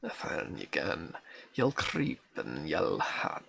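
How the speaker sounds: reading a Scots lullaby poem in a broad Scots accent. Shown as a demonstration. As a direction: roll the Scots words gently, like a granny's old song. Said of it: "forces you to read in an accent".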